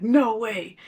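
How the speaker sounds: imitating an upset guy's denial; a touch of outraged disbelief; indignant denial. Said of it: "so blatantly upset".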